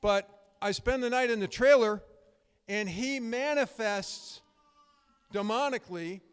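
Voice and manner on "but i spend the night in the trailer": normal talking voice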